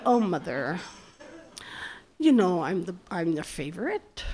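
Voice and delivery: low, soft, knowing tease; retold teasing reply. Daughter insisting she is the favorite, teasingly dismissive. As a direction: playfully brush off the objection, low and quiet.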